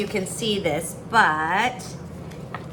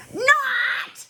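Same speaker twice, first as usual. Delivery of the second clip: much higher shrieked Not; mock scream. Sister screaming back at her taunting brother, high mock shriek. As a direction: squeal it back like a cross kid. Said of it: "I screamed back".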